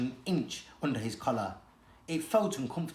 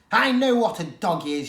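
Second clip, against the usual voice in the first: louder, curt retort; snapped; 4 semitones higher, 8 dB louder than usual. Acted. She-cat snaps at Varjak, louder and curt. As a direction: snap it back, offended. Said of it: "she snapped".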